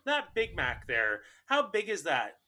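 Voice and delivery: put-on high voice, puzzling over the menu; clueless customer. Clueless customer quizzing fast food worker about Big Mac, mock high voice.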